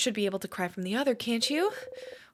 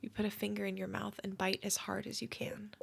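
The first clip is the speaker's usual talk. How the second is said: whispered; 8 dB quieter than usual. Shown as a demonstration. Streamer rereading game text, hushed whisper. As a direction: hush it like a secret.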